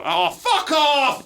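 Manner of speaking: a hammed-up pundit shouting at his rivals; mock furious shout. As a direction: yell it at the telly like a bitter fan. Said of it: "always like, go".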